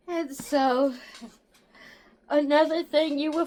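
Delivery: words stretched by a yawn. Real, not acted. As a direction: say it mid-yawn. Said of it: "I do a lot of yawning".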